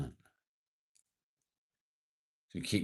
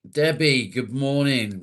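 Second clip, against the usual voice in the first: higher and louder cheerful hello; excited greeting; 7 semitones higher, 11 dB louder, slower than usual. Real, not acted. Streamer welcomes Debbie to the stream, loud and excited. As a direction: sing out her name in welcome.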